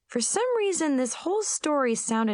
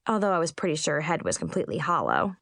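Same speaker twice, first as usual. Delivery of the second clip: ordinary delivery, nothing marked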